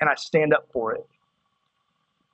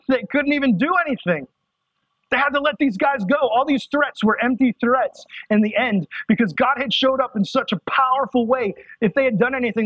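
high, driving preacher's voice, pushing each line; full-force preaching; 6 semitones higher than usual. Real, not acted. Pastor urging the youth group, full-force preaching. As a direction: preach it out with fire, pitch raised.

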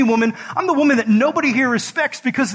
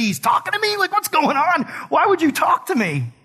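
much higher, bewildered questions; baffled; 7 semitones higher than usual. Retold or imitated. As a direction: sound completely thrown by him. Voicing the confused woman.

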